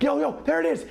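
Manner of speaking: high eager call; excited call. Retold or imitated. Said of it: "I'm like".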